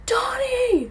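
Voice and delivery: high, loud imitated holler; distant call. Rescuers searching for stranded friend, high calling shout. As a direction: call a name across a dark field. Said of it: "And then I heard".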